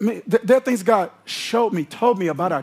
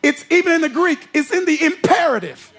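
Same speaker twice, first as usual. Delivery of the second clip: full-force preaching; 6 semitones higher than usual. Acted. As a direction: preach it hard, building to a shout.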